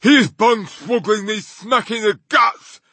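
high, loud giant's holler; indignant, big-voiced accusation; outraged shout. A narrator's reading. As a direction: shout it as if just kicked. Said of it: "shouted the gizzard gulper".

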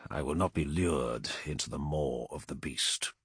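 low, soft, grim resolve; quiet, dark determination; grim smile. A narrator's reading. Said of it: "a grim smile twisted his face".

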